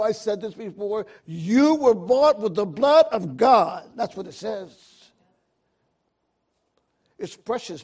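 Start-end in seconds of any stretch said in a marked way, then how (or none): 1.2-3.9 full-force preaching. Acted. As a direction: preach it out with building fire.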